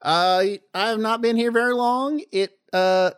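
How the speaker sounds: put-on a flounder drawling in a high southern accent; southern fish voice. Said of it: "I can't do anything that's not southern".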